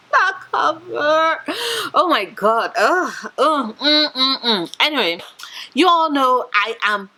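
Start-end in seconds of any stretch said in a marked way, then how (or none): 0.1-4.8 playful fake sob over a pretty cover; mock crying. Booktuber pretends to cry over a stunning cover, mock sobbing. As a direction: whimper like it's too beautiful to bear.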